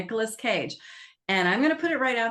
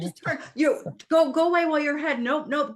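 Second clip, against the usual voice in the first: exasperated laughing plea; 7 semitones higher, faster than usual. Real, not acted. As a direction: urge the screen character to leave, half laughing. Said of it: "I'm like".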